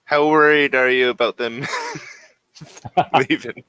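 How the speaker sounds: ordinary delivery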